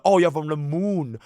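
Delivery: ordinary talk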